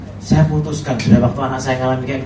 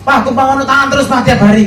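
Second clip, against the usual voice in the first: higher, louder, eager son begging his father; pleading son; 10 semitones higher, 6 dB louder than usual. Retold or imitated. Father retells son begging for blessing, eager high voice. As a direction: play the son asking for prayer every day, laughing a little.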